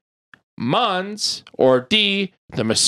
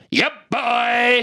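high, stretched-out whoop; hollered catchphrase; 7 semitones higher than usual. Acted. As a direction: holler it like a hype call. Hollered nickname in the sign-off.

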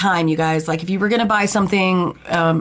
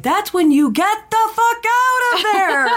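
excited; 12 semitones higher than usual. Retold or imitated. Retelling host's panicked line.

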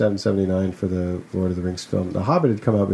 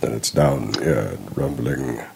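much lower rumbling character voice; pitched-down rumble; 10 semitones lower than usual. Shown as a demonstration. Showing how he finds the character's voice, low rumble. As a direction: roll it around down low and rumbling. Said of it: "have to pitch my voice a bit lower to do the voice".

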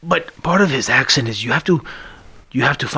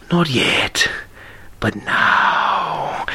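Antonio Banderas whisper; 2 semitones lower, much slower than usual. Retold or imitated. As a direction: whisper it slow and seductive like Banderas. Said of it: "That's how Antonio Banderas talks".

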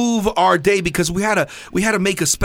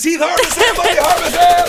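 announcer hype; 14 semitones higher, 4 dB louder than usual. Real, not acted. Introducing guest like an announcer.

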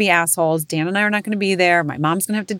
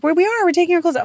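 put-on much higher, louder, fast kid chatter; fast, higher mock-kid excitement; excited kids; 12 semitones higher, 4 dB louder, faster than usual. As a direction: gabble like giddy children. Kids excitedly repeating mom.

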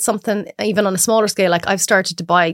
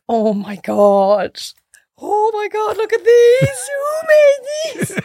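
much higher and slow, laughing disgust; mocking friends; 13 semitones higher, much slower than usual. School friends finding the bad buns, high laughing mockery. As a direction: drag out the shocked teasing. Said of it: "they were like".

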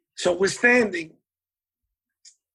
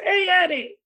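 much higher, mock Marty calling out hey Eddie; imitating Marty's greeting; 13 semitones higher than usual. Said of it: "he used to speak like that".

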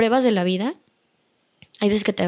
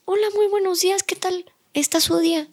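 much higher, sing-song customer-service greeting; friendly greeting; 9 semitones higher than usual. Retold or imitated. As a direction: greet a stranger brightly, pitched way up.

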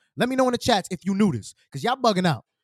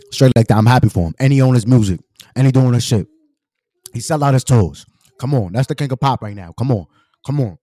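loud, low-pitched hype, driving every line; loud hyped praise; 5 semitones lower, 8 dB louder than usual. Real, not acted. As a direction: pump it up like you're shouting out a friend. Hyped praise for [name].

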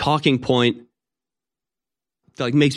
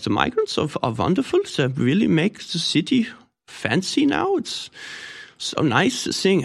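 put-on German accent; 7 semitones higher, 3 dB quieter than usual. German local praising the migrants to a visitor, German accent. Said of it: "imagine talking to some German person".

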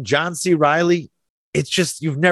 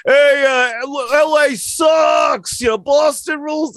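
hammed-up Boston fan yelling, higher and slow; Boston accent; 8 semitones higher, slower than usual. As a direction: shout it like a rowdy Boston heckler in a Boston accent. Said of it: "in a Boston accent says".

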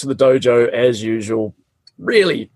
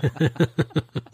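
mocking laugh; 4 semitones higher, 7 dB quieter than usual. Teacher laughing, taunting.

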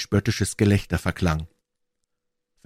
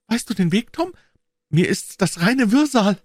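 put-on high, anxious girl's voice; a scared little girl asking to turn back; timid high girl pleading; frightened girl; 4 dB louder than usual. A narrator's reading. Narrator voices Becky.

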